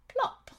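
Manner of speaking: very high little plop; high pinging plop; plop sound. A narrator's reading. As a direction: drop it like a tiny raindrop. Raindrop sound.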